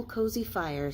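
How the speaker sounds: ordinary delivery, nothing marked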